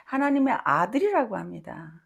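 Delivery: normal talking voice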